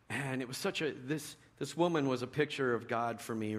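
normal talking voice

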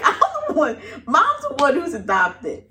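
laughing. Retold or imitated. Mocking kids' logic, laughing.

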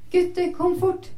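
called out high toward another room; calling out. Acted. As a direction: summon the boys from afar. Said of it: "ropte moren".

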